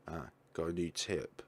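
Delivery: ordinary delivery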